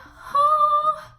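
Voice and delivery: big relieved sigh, high and loud. Real, not acted. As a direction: let out a huge sigh of relief. End of near-death story.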